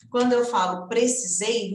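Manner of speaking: ordinary delivery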